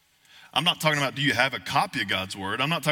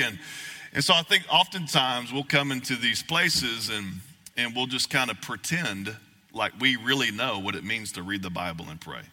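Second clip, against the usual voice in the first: usual speaking voice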